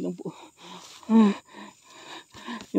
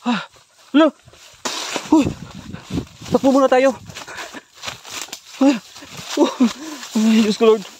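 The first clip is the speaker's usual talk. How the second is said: loud urgent call to run; panicked shout; 6 semitones higher, 7 dB louder than usual. Real, not acted. Gamer fleeing the monster, loud panicked shout. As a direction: shout it while bolting.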